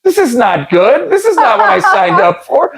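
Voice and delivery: high comic complaint breaking into laughter; mock dismay. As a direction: protest while starting to crack up.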